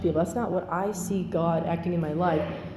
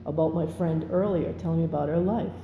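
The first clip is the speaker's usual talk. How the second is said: ordinary talk, nothing marked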